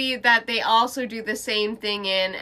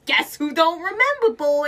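higher, cocky parting line; mock sassy quip; 7 semitones higher than usual. Mocking the husband giving up as he walks out. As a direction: toss it off like a smug punchline. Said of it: "be like".

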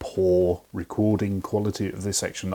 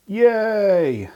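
high, drawn-out yay; excited cheer; 12 semitones higher, 5 dB louder than usual. Real, not acted. Host to listeners about next week's questions, excited cheer. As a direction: swoop up high and stretch out the cheer.

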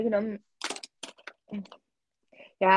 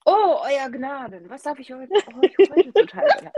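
courtly servant voice; 5 semitones higher, 6 dB louder than usual. Pretend. Acts a servant addressing nobility.

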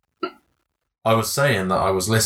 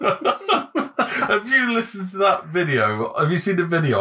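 words squeezed out through high laughter; laughing; 9 semitones higher, slower than usual. Real, not acted. Asking his friend about the video while laughing. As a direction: ask it while cracking up, slowly. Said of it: "laughing while asking".

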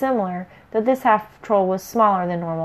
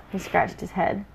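ordinary delivery

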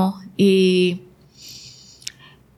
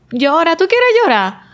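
permissive coaxing; 8 semitones higher, 3 dB louder than usual. Retold or imitated. Repeating her permission to cry.

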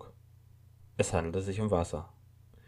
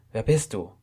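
startled; 3 semitones higher, 5 dB louder than usual. A narrator's reading. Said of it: "erwiderte er erschrocken".

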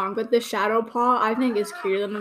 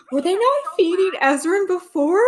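high, louder startled question; gasp, then ask in disbelief; sudden alarmed realisation; shocked gasp; 8 semitones higher, 6 dB louder than usual. Real, not acted. Shocked realisation mid-scene.

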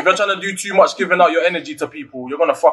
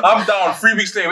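normal talking voice

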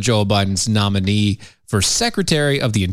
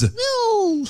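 high squeak. Acted. Mock disbelief at Reid.

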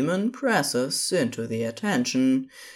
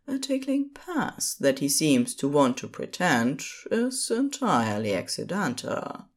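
plain reading voice

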